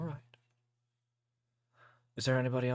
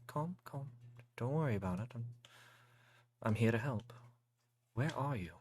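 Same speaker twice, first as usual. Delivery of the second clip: reassuring; 8 dB quieter than usual. Acted. Soothing a scared child.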